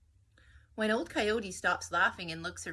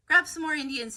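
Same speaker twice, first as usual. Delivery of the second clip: loud, higher, commanding; barked order; 8 semitones higher, 9 dB louder than usual. A narrator's reading. Columbus ordering his men to seize more, loud command. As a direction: bark the order at the crew.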